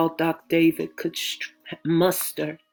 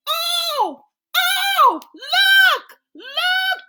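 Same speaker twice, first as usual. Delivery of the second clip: very high excited cry; excited exclamation; 6 dB louder, much slower than usual. Acted. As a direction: gasp it out in delight. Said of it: "Jolene exclaimed".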